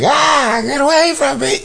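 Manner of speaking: high, panicked "get away from me"; scared yelp. Retold or imitated. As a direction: squeal it like a terrified man. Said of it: "the dude was like".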